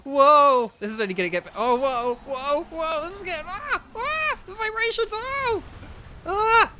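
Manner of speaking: mounting high-pitched wonder; excited reaction. Real, not acted. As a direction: ride the vibrations with rising awe. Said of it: "I gotta react".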